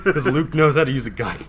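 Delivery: loud, high, amused retelling; laughing. Real, not acted. Telling co-host about Luke stunning Poe, laughing. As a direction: tell it loudly through laughter.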